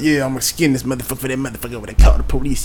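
fast, soft country mutter; mumbling to himself. Retold or imitated. Old man skinning the raccoon, fast low mutter. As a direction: grumble it quick under your breath. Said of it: "he's, like, mumbling to himself".

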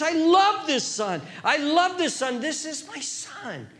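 delighted father God. Acted. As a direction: proclaim love with rising delight. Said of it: "Here he's describing a delight".

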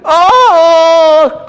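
loud high scream of pain; pained cry. A narrator's reading. Superman hit by the blast of music, crying out. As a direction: cry out as the noise hits. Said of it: "cried Superman".

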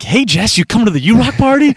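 high, loud and cheery call; excited greeting. Retold or imitated. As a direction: burst in all upbeat and loud. Bursting in on tired neighbor.

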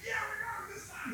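high, gleeful cheer of a plotter; excited gloating. Retold or imitated. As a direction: crow it like you finally caught him. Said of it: "They're all excited".

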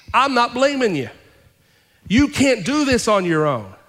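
preacher reassuring the weary congregation, rising; full-force preaching. Acted. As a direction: preach it, building to the peak.

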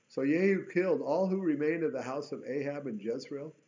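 ordinary talk, nothing marked

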